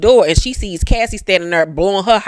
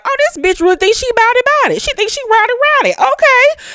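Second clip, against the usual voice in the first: much higher, very fast squealing taunt; Maddie mock high voice; much faster than usual. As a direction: squeal it fast, mocking her rival. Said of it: "Maddie was like".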